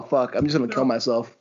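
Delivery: ordinary talk, nothing marked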